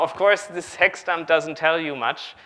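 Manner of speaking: ordinary talk, nothing marked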